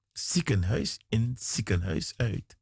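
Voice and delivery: usual speaking voice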